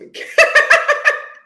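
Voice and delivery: loud high laugh bursting out; a real laugh at loving to sleep in; burst of laughter. Real, not acted. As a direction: laugh out loud at your own joke. Laughs at her own sleep joke.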